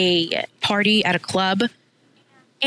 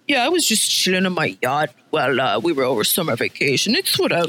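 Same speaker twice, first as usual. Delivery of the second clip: put-on lower, lazy posh rich-kid brag; 6 semitones lower, faster than usual. Acting rich kid bragging about yacht.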